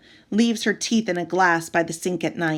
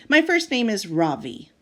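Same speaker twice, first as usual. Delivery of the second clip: plain reading voice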